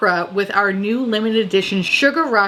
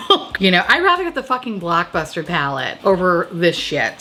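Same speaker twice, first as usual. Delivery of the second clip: ordinary talk, nothing marked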